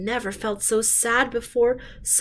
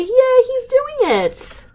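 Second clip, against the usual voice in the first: much higher, loud slow yay; cheering; 4 dB louder, slower than usual. Shown as a demonstration. Reader prompting kids to celebrate Gerald, high loud cheer. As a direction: cheer like a thrilled kid.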